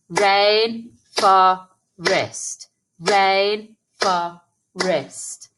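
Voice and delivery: very slow, each syllable of "Rainforest" beaten out; clapped syllables. Shown as a demonstration. Teacher clapping syllables of rainforest with children. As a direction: clap out Rain-for-est slowly. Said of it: "Let's clap the syllables in rainforest".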